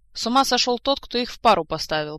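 loud, disgruntled retort; grumbling. A narrator's reading. Commander blames whoever paired the two, grumbling. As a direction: grumble back with a touch of irritation. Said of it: "проворчал командор".

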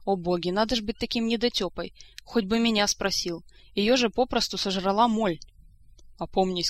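ordinary delivery

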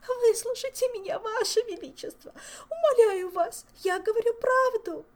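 high, loud begging through tears; tearful pleading. A narrator's reading. Favourite begging the king to hear her. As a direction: beg the king to believe her.

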